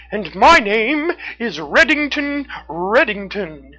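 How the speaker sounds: high, loud, slow proud raccoon; Reddington. A narrator's reading. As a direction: announce your name grandly, high and drawn out. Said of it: "said the raccoon as he stood tall ... proudly".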